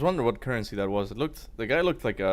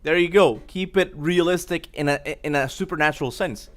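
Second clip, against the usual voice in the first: loud and higher, pleased; excited cheer; 4 semitones higher, 4 dB louder than usual. Real, not acted. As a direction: cheer it like a point scored. Cheering the clip.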